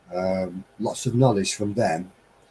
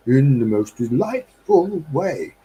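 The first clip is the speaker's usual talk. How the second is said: slow, overly posh British, lightly done; terribly British; 3 semitones higher, slower than usual. Shown as a demonstration. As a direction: put on a too-British voice. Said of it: "one can be terribly British, but overly so".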